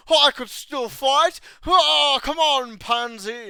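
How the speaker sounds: loud, high-pitched mock bravado; Monty Python impression. Armless fighter goading his foe, hammed-up Monty Python shout. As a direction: shout it like a ridiculous sketch-comedy knight. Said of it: "Monty Python".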